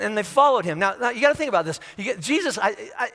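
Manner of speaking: ordinary delivery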